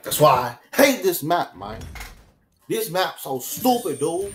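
high, loud complaint about the map; frustrated rant. Real, not acted. Gamer rants at the map after losing.

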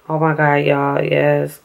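ordinary talk

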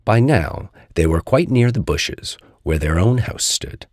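ordinary delivery

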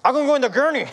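high, loud, sudden willingness, acted; comic eager turnaround. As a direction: blurt it out in mock eagerness. Comic sudden change of mind.

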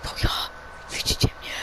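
mysterious unseen voice whispering; very soft, voiceless whisper. Pretend. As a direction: whisper it like a hidden spirit voice. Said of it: "Ty też słyszysz jakieś szepty".

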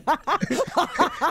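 hearty laugh; big burst of laughter. Real, not acted. Laughing at the joke.

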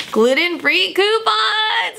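high, drawn-out happy shout; excited cheer. Real, not acted. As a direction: cheer it out, stretching the words. Cheering coupons found in mail.

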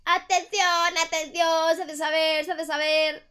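high loud crier call; town crier. Pretend. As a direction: proclaim it to the square.

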